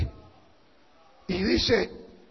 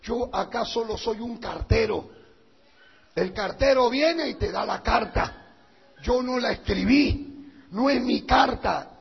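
normal talking voice

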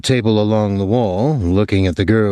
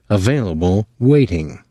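ordinary delivery, nothing marked

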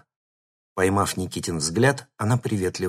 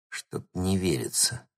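under the breath; 6 dB quieter than usual. A narrator's reading. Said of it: "вполголоса проговорил".

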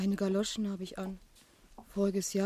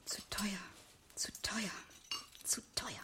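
much softer, whispered, repeating to herself; a bitter thought murmured under the breath; whispered inner voice; 10 dB quieter than usual. Acted. Christine's bitter inner repetition.

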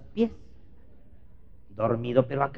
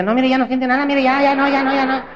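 high, loud nurse voice; insistent nurse; 12 semitones higher, 12 dB louder than usual. Retold or imitated. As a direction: push the reassurance shrilly. (enfermera insistente, aguda y fuerte)

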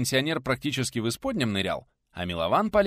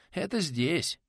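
higher-pitched, breathed-out retort; exhaled reply; 7 semitones higher, much slower than usual. A narrator's reading. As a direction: let it out on a breath. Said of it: "выдохнул пришелец".

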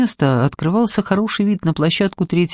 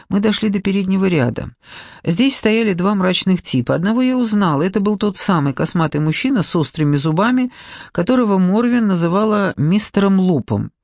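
ordinary delivery, nothing marked

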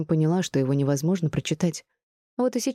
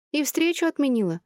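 high-pitched, lightly mournful question; plaintive, pitched-up query; sorrowful question; 10 semitones higher than usual. A narrator's reading. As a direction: ask with a touch of disappointment. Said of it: "печально поинтересовалась подруга".

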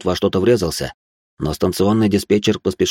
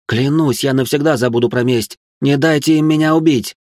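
desperate pleading; 7 semitones higher, 4 dB louder than usual. Acted. Abelot begging for life.

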